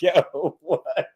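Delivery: laughter swallowing the last words; cracking up. Retold or imitated. His reaction to fans' gripes, cracking up. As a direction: laugh until the words die away. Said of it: "yo, I be crying".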